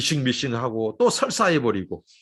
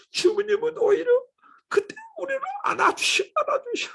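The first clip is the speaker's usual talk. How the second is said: tearful cry. Real, not acted. Preacher to the congregation, choked with tears on God's embrace. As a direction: voice breaking up high into a cry.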